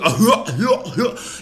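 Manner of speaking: scornful laugh. Real, not acted. Laughs after mocking cops.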